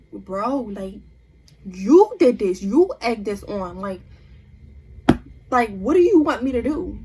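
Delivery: loud, exasperated pushback at her sister; exasperated retort. Retold or imitated. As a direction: cut her off, fed up and loud. Confronting sister at mediation.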